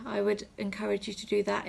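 ordinary delivery

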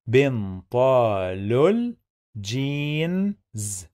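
"بنطال الجينز" slowly, every syllable stretched; slow sounding-out. Shown as a demonstration. Teacher breaking down connected speech, slow and stretched. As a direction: draw out "بنطال الجينز" piece by piece, holding the vowels. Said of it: "Here we have connected speech".

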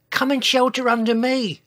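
high, loud urgent invitation; Sizo. A narrator's reading. Dinosaur offering babies cover from ash, high urgent call. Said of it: "said Sizo".

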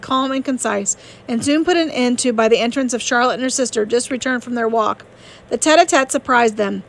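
usual speaking voice

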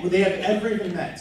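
fast, falling on they; fast, falling restatement of the question; quick restatement. Real, not acted. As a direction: rattle it off again, settling down.

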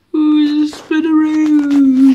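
put-on dizzy kid calling out slowly; slow loud dizzy call. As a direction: stretch it out loud like you're dizzy. Dizzy playful question.

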